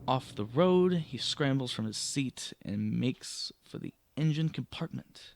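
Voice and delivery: projected storytelling voice; story narration. Acted. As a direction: read it out like a game master setting the scene. Reads the adventure text aloud.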